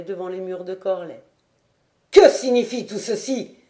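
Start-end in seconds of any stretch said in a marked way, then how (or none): 2.1-3.6 loud, furious demand; terrible cry. A narrator's reading. Lord demands answers from his returning lieutenant, furious shout. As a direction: roar it like a lord betrayed. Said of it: "s'écria Guy Hédert d'une voix terrible".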